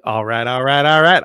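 drawled Texan "all right, all right"; Matthew McConaughey impression. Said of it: "I wish I could do a good enough impression of him".

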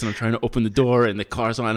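normal talking voice

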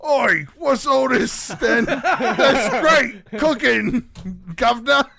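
hammed-up Cockney as Gordon Ramsay; Gordon Ramsay impression. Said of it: "If Gordon Ramsay were here... he would be like".

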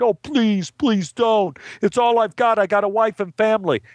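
high-pitched imitation of begging; mock crying plea. Gambler mimicking his broken victims, mock tearful pleading. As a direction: plead pitifully, putting it on. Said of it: "and they cried".

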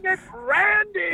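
high, drawn-out yell; whooping prank-caller reveal; triumphant holler. Acted. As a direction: crow it slowly and very high. Caller announces himself as Randy.

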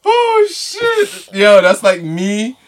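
high, loud, laughing through words; crying with laughter; laughing hard. Real, not acted. Cracking up after the rant.

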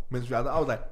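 usual speaking voice

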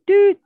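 short, high, loud electronic beep; imitated voicemail beep. Playing a voicemail to the listeners, imitated beep. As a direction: mimic an answering machine's beep.

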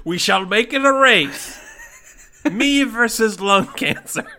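laughing grand declaration. Acted. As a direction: proclaim it, giggling. Player in character, laughing.

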